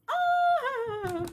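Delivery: high, loud happy shriek; fangirl squeal. Real, not acted. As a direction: squeal at the reunion. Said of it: "I'm fangirling".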